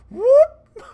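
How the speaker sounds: high loud whoop; sudden high whoop noise; pupils whoop sound. Retold or imitated. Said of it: "you would see my pupils go".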